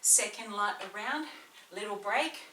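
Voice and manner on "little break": ordinary talk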